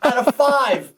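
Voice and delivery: high sarcastic jab; mocking. Real, not acted. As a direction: sneer at the dump. Mocking the hotel.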